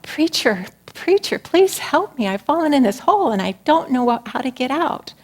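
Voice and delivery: high pleading call from a pit; desperate call for help. Acted. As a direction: cry up out of the hole, begging. Man in hole calls out.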